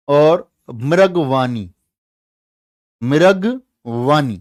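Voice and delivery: names called out slowly and loud for memorising; slow drilled listing. Shown as a demonstration. Teacher slowly reads out the park names to students. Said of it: "next है".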